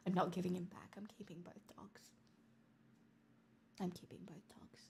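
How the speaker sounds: fast, secretive whisper. Real, not acted. Streamer joking she'll keep both dogs, sneaky whisper. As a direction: confide it like a sneaky plan.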